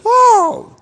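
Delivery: sudden high, loud whoa at the flush; startled whoa. Retold or imitated. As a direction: yelp like the toilet just roared. Plane toilet flush reaction.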